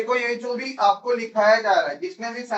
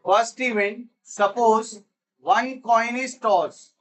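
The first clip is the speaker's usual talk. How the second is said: very slow, word by word; spaced-out classroom dictation; much slower than usual. Real, not acted. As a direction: dictate it slowly for note-taking.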